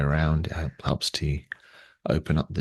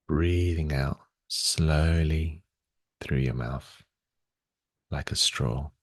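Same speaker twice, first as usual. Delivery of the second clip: unhurried, slightly lowered meditation voice, long pauses; guided meditation. Real, not acted. Guides body awareness and breathing.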